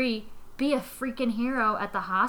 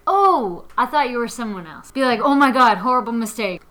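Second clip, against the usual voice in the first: loud fake apology; 8 dB louder than usual. Guy faking a mix-up with his roommate, loud. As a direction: blurt it out like a caught flirt. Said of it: "you could always be like".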